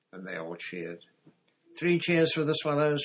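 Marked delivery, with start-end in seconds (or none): 1.8-3.0 raised, higher and louder call; shouted cheer. A narrator's reading. Nancy leads a cheer for the other crew, shouted. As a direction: lead a hearty cheer. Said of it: "shouted Nancy".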